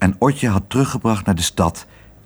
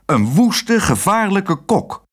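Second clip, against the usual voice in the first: high, indignant accusation; scolding director, pitched up; angry; 10 semitones higher, slower than usual. A narrator's reading. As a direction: stretch the words out in cross alarm. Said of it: "zei de directeur boos".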